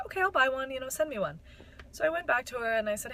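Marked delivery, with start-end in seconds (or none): 0.1-1.4 higher, louder, bright agreement; eager yes. Retold or imitated. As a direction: jump at the offer. Friend agreeing to buy.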